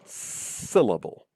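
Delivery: SYLLABLE with a drawn-out, stressed SYL; stress on first syllable. Shown as a demonstration. Lecturer showing which part gets the stress, first syllable emphasized. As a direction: lean slowly on the SYL of SYLLABLE. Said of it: "one of them gets a stress".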